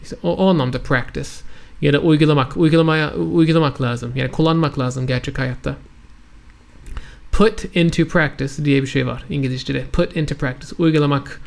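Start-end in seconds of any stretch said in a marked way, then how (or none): none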